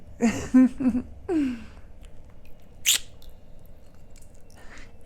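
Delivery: long giggle that dies away; amused laugh. Acted. Dragon-blooded speaker flirting over being lucky, amused giggle. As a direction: laugh lightly, then let it fade.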